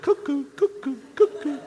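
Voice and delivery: very high cuckoo through a laugh; laughing cuckoo call. Pretend. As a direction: sing the cuckoo, cracking up. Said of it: "Oh, I know a way to do it".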